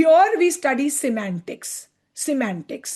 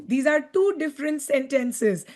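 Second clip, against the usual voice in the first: ordinary delivery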